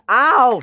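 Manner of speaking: loud, high "ow"; short yelp of pain. A narrator's reading. Dash is hit by Percy, pained yelp.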